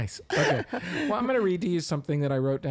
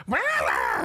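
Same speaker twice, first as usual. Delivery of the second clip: loud mock yap of a wife; mock barking; 6 semitones higher, 6 dB louder than usual. Wife mock-barking at husband, loud wah wah. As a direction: bark it out like a scolding wife. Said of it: "and she barks at me".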